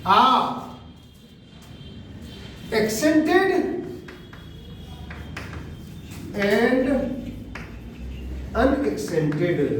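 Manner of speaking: each word set apart by long pauses; slow dictation. Shown as a demonstration. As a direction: dictate it one word at a time. Dictating rest of the phrase. (एक-एक शब्द रुक-रुक कर लिखवाते हुए)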